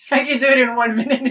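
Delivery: says it through a real laugh; playful boast, chuckling as she speaks. Real, not acted. Jokes about a one-minute summary.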